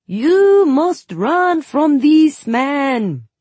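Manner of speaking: high, loud, very slow fake Suli accent; awful Suli accent. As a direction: ham up a dreadful Suli accent. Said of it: "My accent was even worse".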